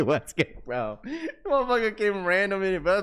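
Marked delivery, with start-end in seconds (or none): none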